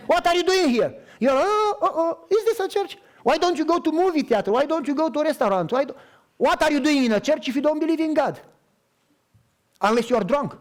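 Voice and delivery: high, loud, exaggerated disbelief; mock incredulous teasing. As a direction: tease her with wide-eyed astonishment. Pastor mock-scolding with laughter.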